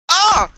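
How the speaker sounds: loud, high yelp; sudden high cry; startled shout. Real, not acted. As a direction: yell out as if ambushed. Caught in the game.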